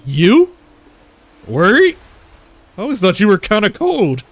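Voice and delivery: teasing disbelief. Acted. Teasing surprise.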